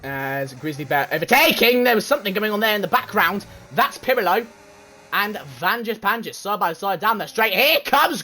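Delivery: fast, lively play-by-play calling the race; race commentary. Acted. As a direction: call every overtake like a live broadcaster.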